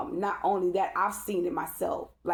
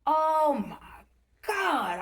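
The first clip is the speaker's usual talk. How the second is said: retold terror; 8 semitones higher, 3 dB louder, much slower than usual. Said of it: "I was terrified".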